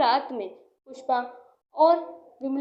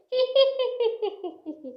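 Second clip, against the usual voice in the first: high, rapid tittering cackle; witch's cackle; 10 semitones higher than usual. Acted. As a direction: cackle gleefully like a wicked witch. Witch arrives at victim's house.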